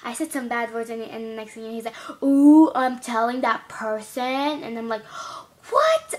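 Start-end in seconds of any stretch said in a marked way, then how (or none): 2.2-4.2 taunting retold threat, loud at the start; mock tattling. Imitates classmate threatening to tattle. 5.7-6.1 high, loud shocked yelp; re-enacted high-pitched outrage; retold shock. Retells own alarmed reaction.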